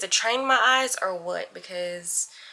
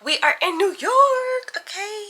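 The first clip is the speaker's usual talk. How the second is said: high, louder announcement; excited greeting; 8 semitones higher, 4 dB louder, slower than usual. Real, not acted. Vlogger announcing to followers she's in New York, excited. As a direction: beam it out to the viewers.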